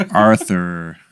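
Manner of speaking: "Arthur" lengthened in a put-on American accent; hammed-up American "Arthur" with a drawn R. Shown as a demonstration. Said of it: "I just turn up to be like".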